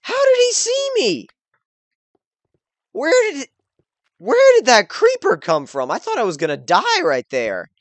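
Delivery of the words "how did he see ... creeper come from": high frantic shrieking questions; panicking gamer. Real, not acted. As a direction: panic under fire, voice climbing. Ambushed by skeleton and creeper.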